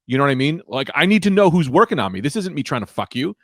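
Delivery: normal talking voice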